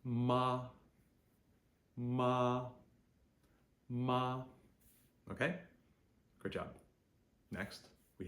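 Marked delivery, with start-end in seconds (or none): 0.0-4.4 MA held high and level; high flat first tone. Shown as a demonstration. Teacher models first tone, MA high and flat. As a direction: sing MA on one steady high note. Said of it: "high, flat".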